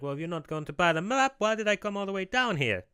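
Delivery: high, mock-indignant cartoon salesman; a bit pissed off. Map seller to customer who won't buy, high peeved voice. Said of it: "He's getting a bit pissed off".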